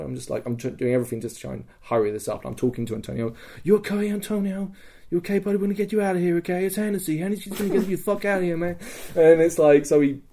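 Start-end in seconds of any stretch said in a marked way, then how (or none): 3.6-8.8 high, hurried comforting voice; retold urgent reassurance. Hennessy rescues fallen Antonio, high urgent reassurance. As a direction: keep the casualty with you.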